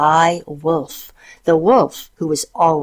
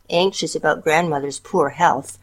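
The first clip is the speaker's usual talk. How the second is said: ordinary delivery, nothing marked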